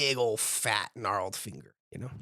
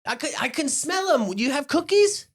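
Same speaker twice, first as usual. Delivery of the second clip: put-on very high, squeaky, wheedling kid voice; cheeky grandson; 5 dB louder than usual. Jinx wheedling his granny for cookies, very high and cheeky. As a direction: chirp it like a cheeky kid angling for treats. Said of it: "And he's like".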